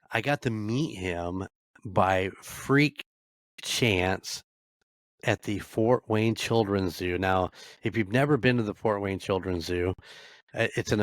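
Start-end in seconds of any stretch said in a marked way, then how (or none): none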